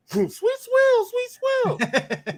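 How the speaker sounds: very high imitated whoop. Opponent flying by the rookie in the second half, imitated high-pitched taunt. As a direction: squeal it like he is flying past. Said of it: "He got a high voice".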